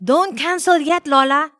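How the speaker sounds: put-on high, loud pleading boy; child's urgent plea. A narrator's reading. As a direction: beg grandma urgently. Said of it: "Noel pleaded".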